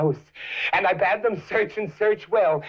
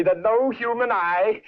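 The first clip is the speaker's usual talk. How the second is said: usual speaking voice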